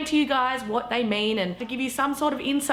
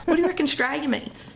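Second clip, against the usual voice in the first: usual speaking voice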